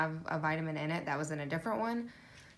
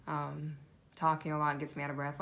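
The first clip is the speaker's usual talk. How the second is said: ordinary delivery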